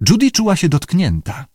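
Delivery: ordinary delivery, nothing marked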